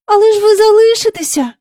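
put-on high, loud little girl's plea; fervent pleading. A narrator's reading. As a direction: beseech her urgently, like a child. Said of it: "гаряче благала Джейн".

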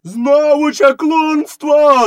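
very high, drawn-out outcry; villagers shouting. A narrator's reading. Villagers crying sorcery after the gunshot, shouting. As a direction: cry it out slowly in a strained high yell. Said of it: "закричали селяни".

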